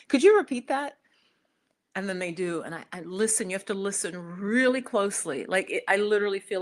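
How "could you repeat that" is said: high, loud mock plea; exaggerated puzzled asking; baffled polite request. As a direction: act out not understanding, pitched way up. Acting out baffled request to locals.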